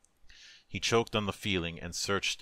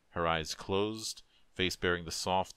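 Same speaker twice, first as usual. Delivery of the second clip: ordinary delivery, nothing marked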